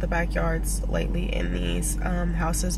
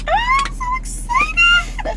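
high, slow, crying-out squeal; excited shriek; 7 dB louder, much slower than usual. Real, not acted. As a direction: squeal it out, near tears. Shrieking about house hunting.

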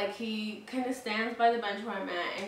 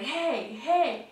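imitated insistent hey, rising higher; calling for attention; 3 semitones higher, 4 dB louder than usual. Drunk man leaning in toward her, imitated calling out. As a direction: keep trying to get her attention. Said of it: "trying to get my attention".

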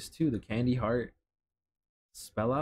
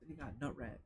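hushed aside; 15 dB quieter than usual. Acted. Hushed aside about nut rags.

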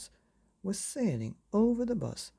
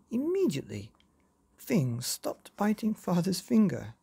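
ordinary delivery, nothing marked

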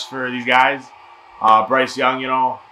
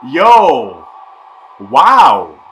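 excited shout; 9 dB louder than usual. Real, not acted. Shock at surprise pick.

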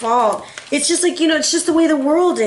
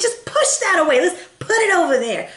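lightly voiced little girl, higher pitch; a hint of a child insisting, pitched up; imitating daughter; 7 semitones higher than usual. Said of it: "She was like".

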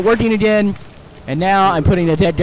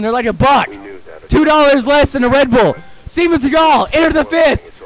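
higher, insistent mock endorsement; emphatic sales pitch; 6 semitones higher than usual. Gamer denying he sold out while plugging a drink, emphatic pitch.

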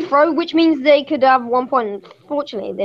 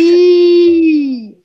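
long loud high yee; sudden yelp; 4 semitones higher, 8 dB louder than usual. Real, not acted. Reacting to the online game with a loud high yell. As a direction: let out a sudden high cry. Sudden outburst while gaming.

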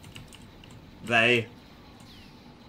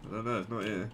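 ordinary delivery

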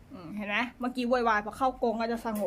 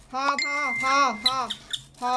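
loud, repeated telling-off; loud stop command; 3 semitones higher, 6 dB louder than usual. Real, not acted. Owner ordering her prairie dog to stop, loud. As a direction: shut it down, firm and loud. Owner stops noisy pet.

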